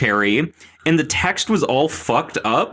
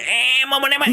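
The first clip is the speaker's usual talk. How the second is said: high, lightly voiced squeaky creature; a hint of a tiny alien; weird puppet voice; 11 semitones higher, faster than usual. Imitating Babu Frick, weird creature voice. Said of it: "He speaks, like, in a weird fucking voice".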